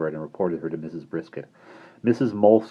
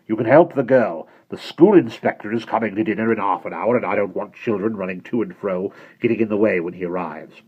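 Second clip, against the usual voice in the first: louder, hard commanding voice; stern headmistress; 5 dB louder than usual. Acted. Missus Brisket ordering Bonnie, stern and suspicious. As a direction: order her about and eye the boy.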